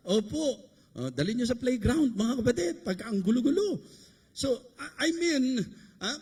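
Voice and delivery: ordinary talk, nothing marked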